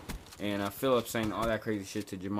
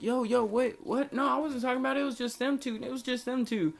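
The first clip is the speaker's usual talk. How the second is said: high, panicked pleading in a much higher voice; retelling a teen's panic with a higher voice; freaking out; 13 semitones higher than usual. Retold or imitated. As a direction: squeak out a scared denial. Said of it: "so I'm freaking out".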